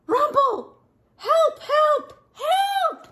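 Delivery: very high, squealed cries; panicked shouting. Acted. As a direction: shriek for help as a tiny terrified critter. Story characters cry for help.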